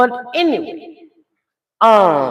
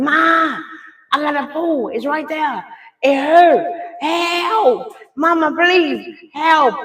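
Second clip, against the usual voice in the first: high-pitched pleading, mildly raised voice; drawn-out, high mock cries for help; imitated screaming; 6 semitones higher, slower than usual. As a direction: imitate a daughter wailing for her mama. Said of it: "She busy screaming".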